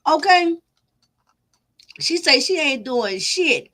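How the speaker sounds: normal talking voice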